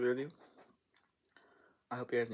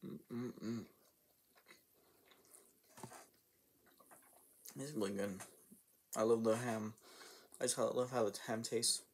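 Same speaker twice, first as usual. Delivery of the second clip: muffled between bites; talking while chewing; 5 dB quieter than usual. Real, not acted. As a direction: mouth full of sandwich. Seen 0: Holds sandwich, takes bites at 3.9, 6.1, 12.8, and chews between.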